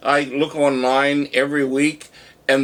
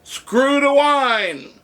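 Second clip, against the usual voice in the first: high, drawn-out parting holler; slow high rallying cry; retold call to the room; 10 semitones higher, much slower than usual. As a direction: imitate a customer calling to everyone, long and high. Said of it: "he turned to the room and he said".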